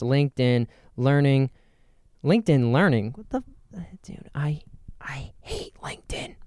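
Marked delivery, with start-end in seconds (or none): 3.1-6.4 whispering. Real, not acted. Aside about hating LinkedIn.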